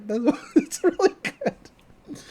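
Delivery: high, slow laugh through the words; laughing praise. Real, not acted. Host gushing about the episode, laughing high. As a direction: giggle while gushing.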